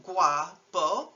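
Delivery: guapo drawn out slowly, ua as one glide; U A as one syllable. Shown as a demonstration. Teacher giving an example, slow single-syllable glide. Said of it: "which is normally one syllable".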